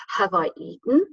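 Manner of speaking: "Have I eaten?" with "eaten" swept upward; rising inflection. Shown as a demonstration. Teacher demonstrating question inflection to students, "eaten" rising. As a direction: make your voice come up on "eaten". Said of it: "Your voice comes up".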